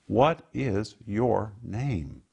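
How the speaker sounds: slow, measured interrogation; Jesus interrogating the demon, slow and measured. Acted. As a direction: ask it slowly, probing.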